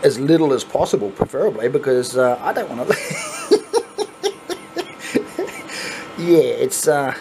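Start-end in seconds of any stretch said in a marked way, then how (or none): 2.9-6.1 long, high pulsing chuckle; real laugh. Real, not acted. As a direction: break off into a drawn-out laugh. Laughs while admitting his losing streak.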